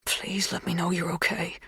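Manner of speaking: whispered private plea; soft, breathy urging. A narrator's reading. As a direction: whisper it to someone who is absent. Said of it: "he urged, conjuring her face".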